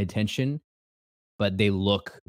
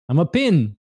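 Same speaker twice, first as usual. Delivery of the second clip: excited; 6 semitones higher, 6 dB louder than usual. Real, not acted. Quiz result announced.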